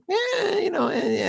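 high nasal-free Leno warm-up; Jay Leno voice. Shown as a demonstration. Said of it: "I can't go right into Jay Leno. I have to do that".

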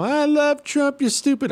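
very high-pitched mocking falsetto; mock angry emailer. Host imitating a Trump fan's email, high mocking voice. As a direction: squeal it like an irate listener being mimicked. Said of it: "I know you're writing me an email".

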